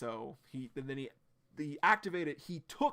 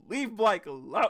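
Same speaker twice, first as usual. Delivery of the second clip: urgent plea; 7 semitones higher, 9 dB louder than usual. Acted. Reading Blyke's panicked thoughts aloud.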